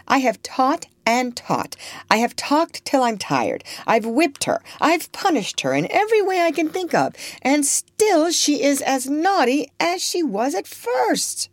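high-pitched, mounting frustration; exasperated Miss Ophelia. Acted. Guardian has tried every punishment in vain, exasperated.

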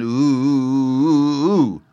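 "ooh" drawn out long, running out thin; thin ooh sound. Shown as a demonstration. Father showing Taffy how the O noise runs out thin. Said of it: "like this".

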